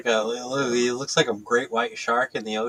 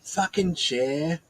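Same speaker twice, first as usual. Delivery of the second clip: slow, raised mock-posh voice; posh accent; 5 semitones higher, 4 dB quieter, much slower than usual. Mimicking the man in the chair video, posh accent. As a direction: drag it out in an imitation posh accent. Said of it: "like, that posh accent".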